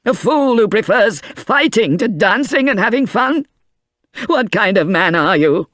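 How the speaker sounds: high, scolding grandfather's voice; angry old man. A narrator's reading. Grandfather scolding his unconscious grandson, angry. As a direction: berate him, hurt and furious. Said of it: "he said angrily".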